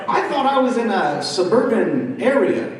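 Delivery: normal talking voice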